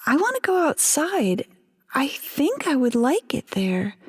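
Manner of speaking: put-on reach for it like a child's wish; longing wife.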